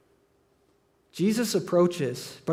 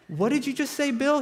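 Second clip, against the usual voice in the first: stunned disbelief; 8 semitones higher than usual. Retold or imitated. Friends stunned by Bill's remark.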